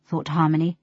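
usual speaking voice

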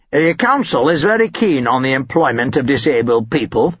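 lightly voiced prim official; a hint of a fussy bureaucrat; official's voice. A narrator's reading. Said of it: "he was heard to remark".

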